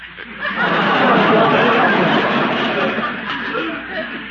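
loud high belly laugh; big hearty laugh. Acted. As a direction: laugh it up hard. Big laugh at his own joke.

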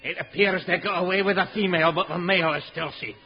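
gruff Scottish inspector; Scottish accent. Acted. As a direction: speak as a dour Scottish policeman.

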